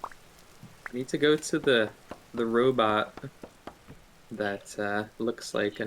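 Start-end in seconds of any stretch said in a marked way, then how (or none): none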